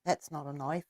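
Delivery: low, soft mock Australian; hushed, low Aussie movie-quote accent. Quoting Crocodile Dundee, put-on Australian accent. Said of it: "is Australian".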